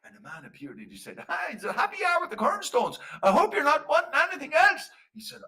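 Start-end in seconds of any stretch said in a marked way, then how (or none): 1.3-4.9 high, loud fairy voice; fairy man. Acted. Fairy man greets his visitor at the Quernstones, hollering high.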